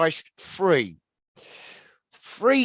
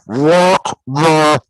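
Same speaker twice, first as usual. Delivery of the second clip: slow, loud orc bellow; drawn-out dopey monster roar; dopey cartoon bellow; 11 dB louder, much slower than usual. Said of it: "the orc says".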